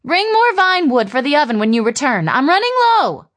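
high calling mother voice. A narrator's reading. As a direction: call it across the house. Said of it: "Kalme called out a parting order".

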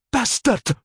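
high, sharp furious cry; spat in fury. A narrator's reading. Woman spitting at her lover through tears, furious. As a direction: spit the word at him through tears. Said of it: "she spat".

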